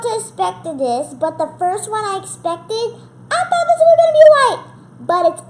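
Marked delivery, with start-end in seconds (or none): 3.3-4.6 high, loud and fast; blurting out the surprise; excited. Real, not acted. Still shocked by weight.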